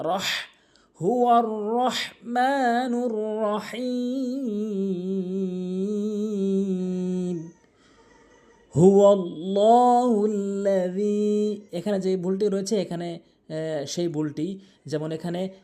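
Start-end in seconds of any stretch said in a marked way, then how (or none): 0.1-0.2 "রাহ" ending in a pressed mid-throat Arabic H. Shown as a demonstration. Teacher shows the big H, "রাহ" pressed from mid-throat. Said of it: "হলকের মাঝখান থেকে উচ্চারণ করতে হবে চাপ দিয়ে". 1.0-11.6 slow, sustained chanting with long-held vowels; Quran recitation. Shown as a demonstration. Teacher opens the Quran lesson with a verse, slow melodic recitation.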